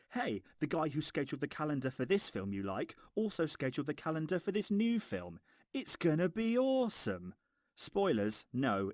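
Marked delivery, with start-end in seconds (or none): 0.1-7.3 higher-pitched excited pitch, rising to a peak on the last sentence; mock trailer hype. Reviewer parodying what the trailers are really saying, mock hype. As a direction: oversell it like an over-eager film promoter. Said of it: "what these trailers are actually saying".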